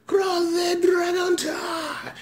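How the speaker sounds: slow, high, stretched battle cry; attack callout. Retold or imitated. Naming the finisher move as a long high shout. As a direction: belt it out like the finisher call.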